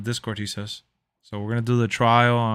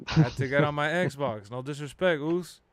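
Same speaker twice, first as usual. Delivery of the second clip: ordinary talk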